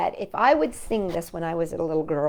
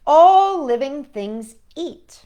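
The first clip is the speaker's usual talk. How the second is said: slow and louder, savouring the ending; slow emphatic read-aloud; 6 semitones higher, 9 dB louder, slower than usual. A narrator's reading. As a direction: build to the big finish. Book's big closing line.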